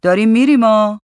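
loud heads-up that it's time to go; loud announcement. A narrator's reading. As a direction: call it out to the room. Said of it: "وحید بلند گفت".

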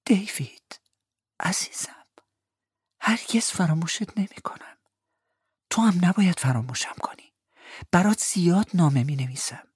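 loving whispered promise; tender whisper. Acted. Peggotty whispers a tender goodbye to David at the keyhole. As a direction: whisper your love through the door.

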